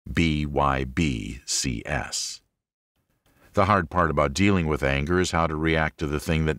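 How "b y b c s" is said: letters spaced out slowly and lower; slow spelled acronym. Acted. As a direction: spell the motto like a chant.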